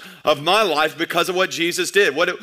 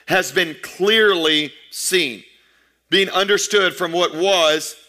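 slow emphatic reading; slower than usual. Acted.

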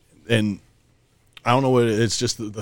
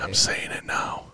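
secretive whisper; 6 dB quieter than usual. Real, not acted. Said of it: "Why are you whispering?".

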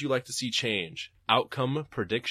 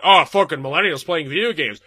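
mocking hack comic; 4 semitones higher, 12 dB louder than usual. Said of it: "you're like a bad Jerry Seinfeld stand-up".

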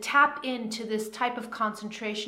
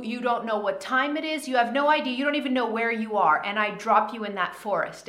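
usual speaking voice

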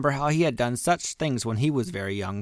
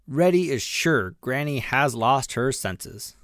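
ordinary delivery, nothing marked

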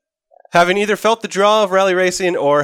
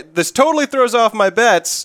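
usual speaking voice

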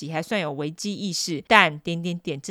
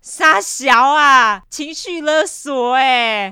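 high, loud, very slow outrage; drawn-out disbelief; appalled drawl; 6 semitones higher, 9 dB louder, much slower than usual. Real, not acted. Appalled reaction.

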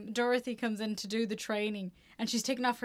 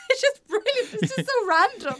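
laughing; 6 semitones higher, 7 dB louder than usual. Real, not acted. Laughing delight.

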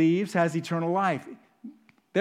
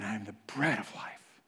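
hushed; 9 semitones lower, 10 dB quieter than usual. Acted. Soft solemn voicing.

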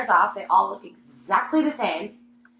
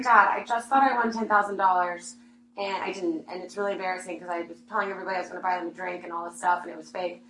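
much softer, subdued embarrassed telling; retold tearful call; 5 semitones lower, 8 dB quieter than usual. Calling dad from her cubicle after the fake ticket, subdued and tearful. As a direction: talk low as if holding back tears. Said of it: "he can tell that I'm crying".

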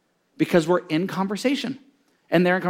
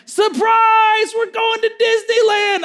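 surprise shout; 12 semitones higher, slower than usual. Retold or imitated. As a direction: burst in yelling the happy news. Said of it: "my dad and brothers run in".

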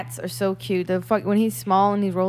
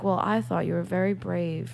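softer, subdued imitation; depressed, scared; 6 dB quieter than usual. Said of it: "depressing and they were scared to death".

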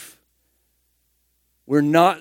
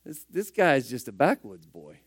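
lower, much softer scoffing aside; skeptical listener; 7 semitones lower, 7 dB quieter than usual. Retold or imitated. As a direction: mutter it like a doubting onlooker. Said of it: "And I'm like".

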